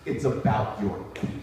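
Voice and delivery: low, quiet deadpan; low deadpan line; deadpan Bobby. Retold or imitated. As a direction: say it flat and low.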